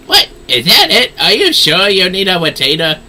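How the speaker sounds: loud incredulous NPC. Acted. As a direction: throw it out in loud mock disbelief. Voices NPC reacting.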